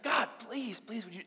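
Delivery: high-pitched begging prayer; imitated pleading. Brother begging God for his sister, imitated pleading. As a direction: act out an earnest plea.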